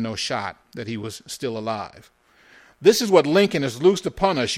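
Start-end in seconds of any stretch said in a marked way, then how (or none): 2.8-4.6 loud, high-pitched complaint; indignant rant. A narrator's reading. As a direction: rail bitterly at what has happened. Jakey rants with gun raised.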